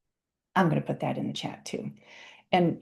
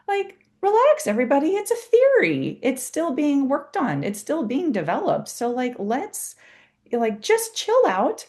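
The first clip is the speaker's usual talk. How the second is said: exasperated eye-roll; 9 semitones higher than usual. Real, not acted. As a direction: wave the fuss away with amused exasperation. Teasing the polyvagal hype.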